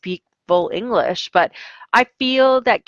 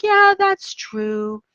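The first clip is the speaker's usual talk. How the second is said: high, slow, mopey agreement; imitating a down teenager, slow and high; glum student; 12 semitones higher, much slower than usual. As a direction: drag it out like a dejected teen.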